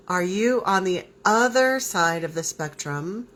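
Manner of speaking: ordinary talk, nothing marked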